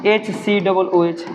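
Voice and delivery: "एच सी डबल ओ एच" said very slowly, each letter separate; slow spelled-out formula. Shown as a demonstration. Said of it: "क्या इसको हम ऐसा लिख सकते हैं".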